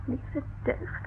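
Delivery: breathless whispered exclamation; hushed gasp. Acted. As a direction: gasp it under your breath, alarmed. Maid startled by inspector's name.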